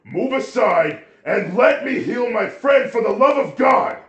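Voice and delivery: furious full-volume scream, pitched very high; shouting at the top of the voice; angry shout. A narrator's reading. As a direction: explode with mounting rage. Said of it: "Getting more and more angry".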